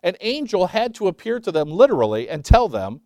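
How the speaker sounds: ordinary talk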